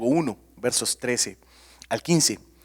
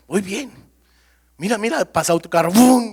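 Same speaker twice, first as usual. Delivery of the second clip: higher, louder, thrilled boast; husband brushing off his wife, getting excited; mock excited driver; 7 semitones higher, 8 dB louder than usual. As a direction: brag like a kid racing past cars. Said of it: "No, mi amor".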